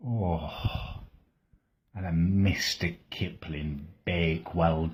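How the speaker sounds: slow, sighing list of lost foods; wistful tearful longing. Acted. Vegan dreaming of the junk food he misses, slow and tearful. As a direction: savour each name like a lost love. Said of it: "Oh, I'm tearing up".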